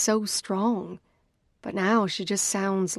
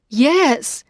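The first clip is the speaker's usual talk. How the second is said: loud shrill yell into the phone; scream; 6 semitones higher, 9 dB louder than usual. A narrator's reading. Sister provoked into screaming down the phone. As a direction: scream the word at full force. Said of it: "she screams into the phone".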